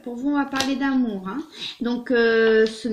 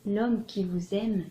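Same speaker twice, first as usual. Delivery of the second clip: measured, expressive literary reading with swells and pauses; dramatic love-letter reading; 4 dB louder than usual. Acted. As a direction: read it as an ardent lover's confession. Reads a love letter aloud.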